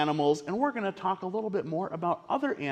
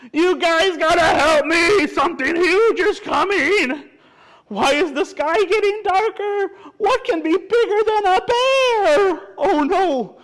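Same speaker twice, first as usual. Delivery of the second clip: high, loud, frantic storybook yelling; shrieky critter panic; panicked animal characters; 12 semitones higher, 9 dB louder than usual. Acted. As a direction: cry out in hammed-up alarm. Animals panic at something huge coming.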